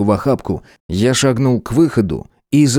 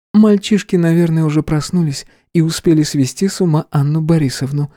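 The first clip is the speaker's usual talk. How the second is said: female character voice; 6 semitones higher than usual. Acted. Kara voiced, higher and brighter.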